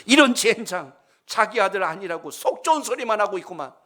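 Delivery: father cursing the departing doctor; angry curse. Retold or imitated. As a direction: spit the curse after him. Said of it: "하고 소리를 쳤어요".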